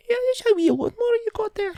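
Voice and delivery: very high, fast Scottish caricature; squeaky put-on Scottish patter; mock Scottish accent. Asking to see a lawnmower, mock Scottish accent. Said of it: "to say in a Scottish accent".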